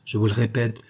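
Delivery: plain reading voice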